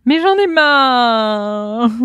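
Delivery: loud, high, long-drawn mock complaint; hammed-up fed-up cry; mock exasperated wail. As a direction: wail it out hugely, stretching the last word. Mock outburst, then laughs.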